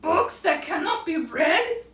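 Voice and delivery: high, slow mock-weepy lament; hammed-up owl grief, words stretched out; tearful Owl. A narrator's reading. Owl drops tears into the kettle, high mock lament. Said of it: "More tears dropped down".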